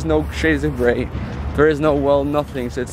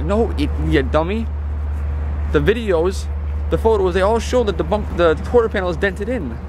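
exasperated rant; 6 semitones higher than usual. Real, not acted. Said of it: "And it makes me so mad".

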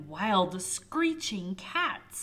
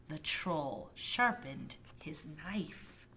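suspenseful whisper; 5 semitones lower, 5 dB quieter, slower than usual. A narrator's reading. Suspenseful narration.

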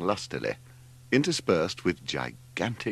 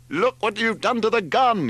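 high, loud yell of outrage; furious yell; 5 dB louder than usual. A narrator's reading. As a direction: yell it furiously, pitched right up. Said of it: "yelled Leslie furiously".

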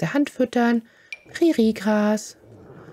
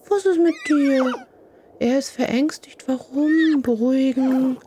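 worried high voice; 4 semitones higher than usual. Real, not acted. Player anxiously asking her scared foal what's wrong. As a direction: fret over the frightened foal.

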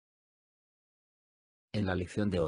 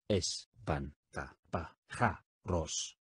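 "Espantapájaros" stretched out very slowly, syllable by syllable; 4 semitones lower, 4 dB quieter, much slower than usual. Shown as a demonstration. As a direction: drag out "Espantapájaros" slowly, each syllable distinct. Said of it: "dila silábicamente conmigo". (muy despacio, por sílabas: "Espantapájaros")

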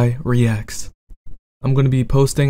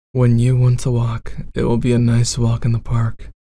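bad boy roleplay.

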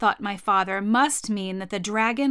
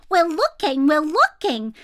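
high, louder, eager monkey chatter; little monkeys; 6 semitones higher, 6 dB louder than usual. Acted. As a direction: squeak it out like busy little monkeys. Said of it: "said the six little monkeys".

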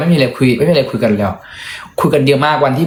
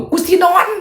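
much higher shrill yell; inner voice yell. Pretend. Voice in his head telling housekeepers he wants to sleep, shrill yell. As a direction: screech it, desperate for sleep. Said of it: "แต่เสียงในหัวกู".

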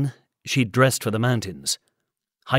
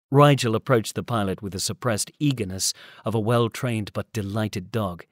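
ordinary delivery, nothing marked

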